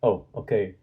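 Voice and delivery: ordinary delivery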